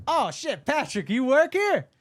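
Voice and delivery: high, loud surprised greeting; imitating David Jolly's startled hello; mock surprise.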